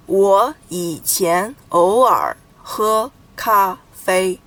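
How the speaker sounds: "我以前偶尔喝咖啡" very slowly, one character at a time; syllable by syllable. Shown as a demonstration. Teacher counting characters for learners, "我以前偶尔喝咖啡" syllable by syllable. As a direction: separate all eight syllables of "我以前偶尔喝咖啡". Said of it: "eight syllables".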